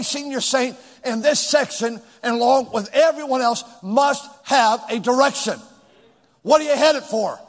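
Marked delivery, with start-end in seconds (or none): none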